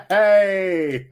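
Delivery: loud, high laughing cheer. Real, not acted. Reader finally finding the date on the bag, laughing exclamation. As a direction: crow it through a burst of laughter.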